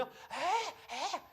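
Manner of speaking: put-on high, drawn-out bird-like baby cries; imitated squawking baby cry. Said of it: "목에서 새소리가 나". (새소리 같은 아기 울음 흉내)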